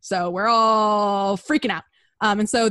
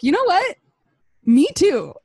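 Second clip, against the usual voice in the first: high, louder swooning reply; giddy reply; 9 semitones higher, 6 dB louder than usual. Retold or imitated. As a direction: gush it back to him. Christine's love reply.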